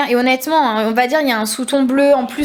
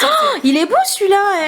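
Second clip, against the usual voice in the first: delighted gush; 7 semitones higher than usual. Real, not acted. Admiring a lipstick.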